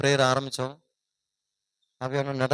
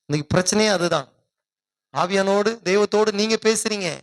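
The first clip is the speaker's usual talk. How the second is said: ordinary talk, nothing marked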